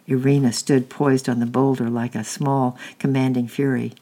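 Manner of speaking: plain reading voice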